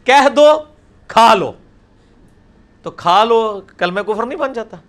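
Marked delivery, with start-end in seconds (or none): 0.0-0.6 emphatic contrast. Shown as a demonstration. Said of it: "کتنا فرق ہے". 1.1-1.5 emphatic contrast. Shown as a demonstration. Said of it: "کتنا فرق ہے".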